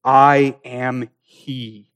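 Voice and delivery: very slow, deliberate words; solemn declaration. Acted. Jesus naming himself to the soldiers, very slow and solemn. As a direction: let each word stand alone. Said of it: "Jesus saith unto them".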